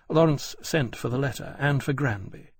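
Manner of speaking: ordinary delivery, nothing marked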